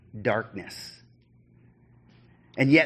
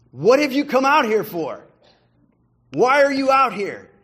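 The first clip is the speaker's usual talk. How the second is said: loud, high jeering challenge from the enemy; mocking call of the besiegers; taunting shout; 10 semitones higher, 8 dB louder than usual. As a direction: hurl it up at him from below. Enemies taunting Aragorn from outside.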